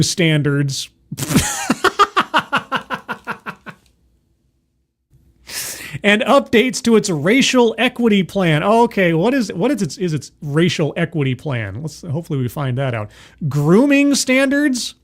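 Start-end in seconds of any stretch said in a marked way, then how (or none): 1.1-3.7 high-pitched spluttering laugh, tailing off; bursting laugh. Real, not acted. Host cracking up at the bureau's grooming standards, bursting laugh. 13.5-14.9 slow, high, questioning disbelief; incredulous echo. Real, not acted. Host questioning an odd phrase from the article, incredulous.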